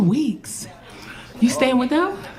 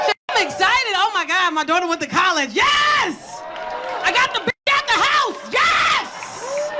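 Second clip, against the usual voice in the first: excited mom; 6 semitones higher, slower than usual. Retold or imitated. Mother celebrating daughter leaving for college, whooping high. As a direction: cheer like the kid finally moved out. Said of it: "She's so excited".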